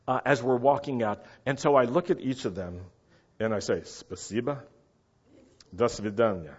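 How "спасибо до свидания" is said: lightly voiced Russian mobster; a hint of Russian mafia in a measured goodbye. Departing diner thanking lined-up waiters, in mock Russian mafia voice. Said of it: "in my best Russian mafia voice".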